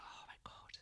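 breathless retold whisper of shock; whispered oh my God, stunned. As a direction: gasp it under your breath as if you can't believe your eyes. Shocked mum sees son smoking.

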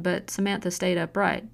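plain reading voice

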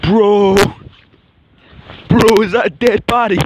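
mock shock. Vlogger to friend spoofing a body discovery, mock shock. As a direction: call out in hammed-up shock.

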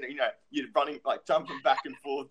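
ordinary talk, nothing marked

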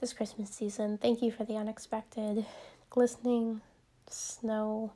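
soft, hushed prayer voice, partly whispered; gentle closing prayer before the episode. Acted. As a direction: pray quietly and reverently, as if to God alone. Soft prayer opening the podcast.

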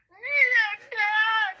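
put-on very high, loud kid's cry; piercingly high imitation of a scared little boy; imitated weird child wail. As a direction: wail "Dad" like a crying child. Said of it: "like, this weird ass".

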